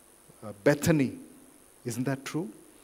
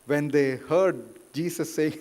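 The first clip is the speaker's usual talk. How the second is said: normal talking voice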